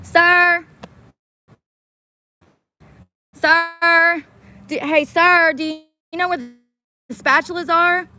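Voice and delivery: put-on high, polite little old lady calling out; imitating old lady. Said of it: "And she says".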